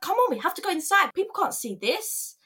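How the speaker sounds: high, hurried imitation; embarrassed manager. Manager pulling employee inside during meltdown, embarrassed urging. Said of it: "She was embarrassed of me".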